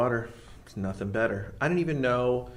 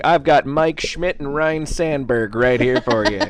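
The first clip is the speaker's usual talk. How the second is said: Anchorman impression; 9 dB louder, slower than usual. Imitating Anchorman line.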